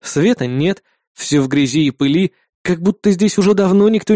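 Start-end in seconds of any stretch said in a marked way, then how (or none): none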